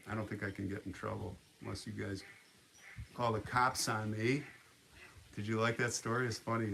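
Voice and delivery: usual speaking voice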